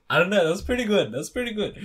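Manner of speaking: laughing. Real, not acted. Laughing at own attempt.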